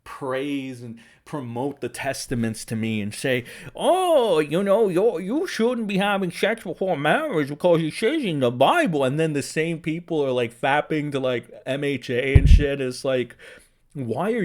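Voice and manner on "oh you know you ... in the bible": sneering impression of a moralizer; mock preachy scold. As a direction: parody a holier-than-thou lecture. Mocking preachy right-wingers.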